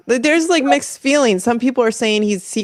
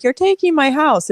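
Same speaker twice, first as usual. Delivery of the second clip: mild, higher-pitched mock yell; retold yell; 8 semitones higher than usual. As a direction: imitate an upset protest, pitched up without shouting. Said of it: "Palestinian woman yelling at him".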